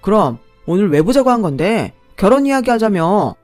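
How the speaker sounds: the bride snaps back at the man, loud and blunt; angry retort. A narrator's reading. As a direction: drop the politeness and fire back. Bride drops politeness, angry.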